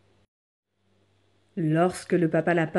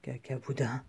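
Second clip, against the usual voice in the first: low, soft sick wolf answer; feeble reply; 5 semitones lower, 9 dB quieter than usual. Acted. Sick wolf answering the doctor, soft feeble reply. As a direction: let it out small and low.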